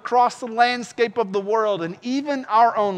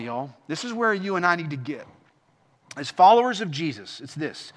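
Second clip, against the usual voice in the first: ordinary talk, nothing marked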